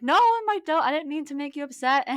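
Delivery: high, loud backpedalling protest; retold flustered protest. Apologising to upset boyfriend, flustered protest. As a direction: protest hurriedly at a jumped-up pitch, re-enacted.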